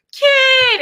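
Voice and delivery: high, loud cry of relief; joyful cry. Retold or imitated. Owner spots her lost cat and cries out with joy. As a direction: call out the cat's name in delight.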